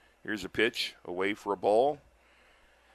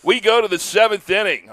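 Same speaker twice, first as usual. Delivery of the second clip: loud announcer call; 9 semitones higher, 10 dB louder than usual. Real, not acted. Announcer restarts after laughing.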